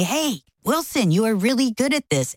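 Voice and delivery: mild encouraging cheer; cheering coach. Retold or imitated. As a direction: imitate a coach cheering on a player. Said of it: "they're cheering for me".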